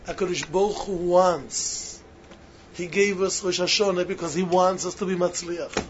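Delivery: normal talking voice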